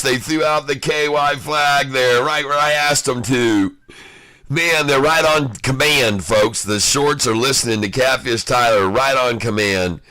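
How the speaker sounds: ordinary talk, nothing marked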